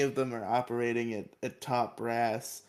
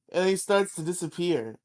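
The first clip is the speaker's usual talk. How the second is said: ordinary delivery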